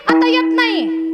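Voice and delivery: high, slow sulky refusal; lightly voiced stubborn old man. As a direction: dig in your heels.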